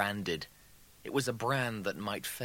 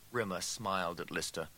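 plain reading voice

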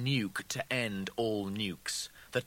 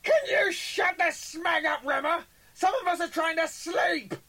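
furious scream; 8 dB louder than usual. A narrator's reading. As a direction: scream it from the next room. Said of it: "the double's voice screamed".

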